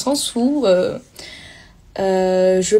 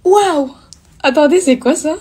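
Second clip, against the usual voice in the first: surprised; 7 semitones higher, 6 dB louder than usual. Real, not acted. Reacting to live effect.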